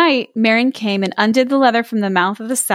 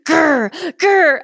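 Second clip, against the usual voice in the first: higher-pitched spoken growls; dog growl; 6 semitones higher than usual. A narrator's reading. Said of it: "Big Dog Bomba is growling and snarling".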